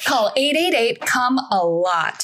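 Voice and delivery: upbeat closing ad read; ad announcer. Acted. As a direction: pitch it like a TV ad tag.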